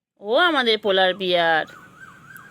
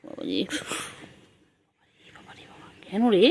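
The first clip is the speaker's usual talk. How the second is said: whisper; 4 dB quieter than usual. Real, not acted. Hushed talk to sleepy pet.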